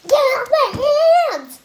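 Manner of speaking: high, loud, slow shriek at the slime; get off. Real, not acted. Kid shrieks at slime stuck on her hands. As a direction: yell at the sticky goo.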